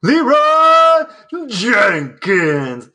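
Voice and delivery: high, loud drawn-out war cry; Leroy Jenkins battle cry. Pretend. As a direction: yell the name like charging into battle. Meme battle cry on request.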